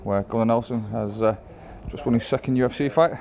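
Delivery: ordinary talk, nothing marked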